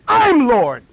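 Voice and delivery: loud retold proclamation; mock boastful claim. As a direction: announce it loudly, imitating a boaster. Retold man boasts he is Lord.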